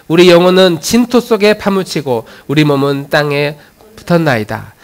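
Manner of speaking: firm, loud congregational recitation; scripture reading. Real, not acted. Pastor leading the congregation in a psalm of lament, firm recitation. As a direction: read the lament out strongly, phrase by phrase.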